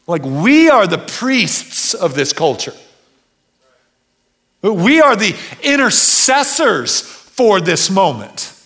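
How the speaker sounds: loud, slow, rising sermon cry; preaching at full force. Acted.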